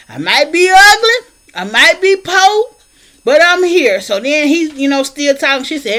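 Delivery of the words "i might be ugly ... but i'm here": defiant declaration. Retold or imitated. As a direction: stand tall and fling each line back at him. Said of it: "And she said".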